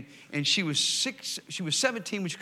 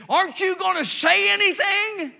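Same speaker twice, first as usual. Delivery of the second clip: loud, much higher, desperate yell; yelling girl; 11 dB louder than usual. Retold or imitated. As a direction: yell it at him like a hurting teenager. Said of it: "she yells at me".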